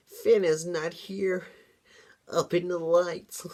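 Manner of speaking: high, wavering sinister demon voice; prophesy doom like a creepy little demon; hammed-up evil demon foretelling doom; demon's prophecy. Kim's ominous prophecy acted.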